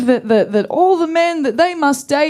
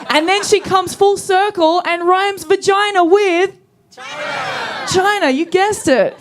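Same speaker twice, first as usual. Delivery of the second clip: higher, slower, hammed-up awe; mock amazement; 8 semitones higher than usual. Real, not acted. Comic praising lyrics rhyming China with China, mock awe. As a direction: build it like a great revelation.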